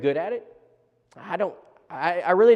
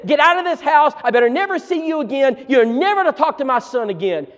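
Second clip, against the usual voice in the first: louder and higher, shouting him out; furious father; 9 semitones higher, 7 dB louder than usual. Retold or imitated. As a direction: bark the threat at the boy. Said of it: "he was so angry".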